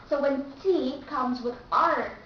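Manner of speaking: ordinary talk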